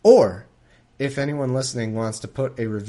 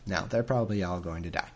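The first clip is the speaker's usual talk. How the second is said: ordinary talk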